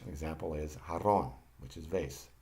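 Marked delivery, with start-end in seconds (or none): 0.9-1.3 jarrón with its J said as English H. Shown as a demonstration. Teacher showing the Spanish J, H sound in jarrón. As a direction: open jarrón on a breathed H sound. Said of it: "pronounced like the H in English".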